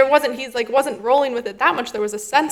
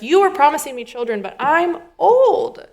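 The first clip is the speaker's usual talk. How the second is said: put-on higher, agitated retelling of an old man's complaint; upset pleading voice, higher in pitch; worked-up Avram; 5 semitones higher than usual. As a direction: act out Avram getting worked up. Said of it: "you can feel him pretty worked up".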